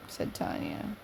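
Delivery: ordinary delivery, nothing marked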